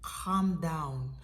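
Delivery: put-on low, unruffled Russian-accented command; curt calming words in Russian accent. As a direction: imitate a steady Russian caller. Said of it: "in my best Russian accent".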